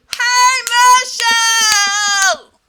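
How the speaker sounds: very high, very loud, drawn-out yell; full yell. Pretend. Her body finally screaming Michelle's name, drawn out. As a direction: bellow the name, stretched out.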